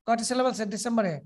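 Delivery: usual speaking voice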